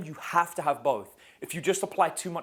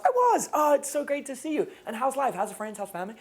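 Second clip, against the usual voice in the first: acted friendly guy; 10 semitones higher, 3 dB louder than usual. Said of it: "So I meet a girl".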